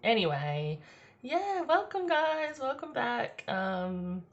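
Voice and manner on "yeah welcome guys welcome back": high, bright sing-song greeting; cheery welcome. Real, not acted.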